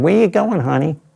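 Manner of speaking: high-pitched mock New York accent. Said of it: "in her finest New York accent".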